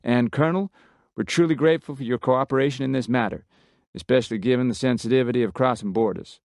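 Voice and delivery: a lightly voiced Texan officer taking charge; Texan commander. A narrator's reading. Miller briefing the special ops team, brisk Texan voice.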